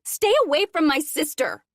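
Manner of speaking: high, loud shout after them; angry call. Acted. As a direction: shout it at their backs. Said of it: "Kendall calls after them".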